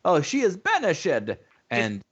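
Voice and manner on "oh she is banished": lightly voiced high breathy quote; mock her overdone line delivery; quoting Keira Knightley. Podcast host quoting the Sugar Plum Fairy, light mimicry. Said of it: "Keira Knightley says".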